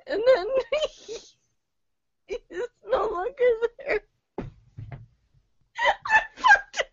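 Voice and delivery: high, breaking with laughter; can barely get the words out; laugh-crying. Real, not acted. Laugh-crying, can't keep reading.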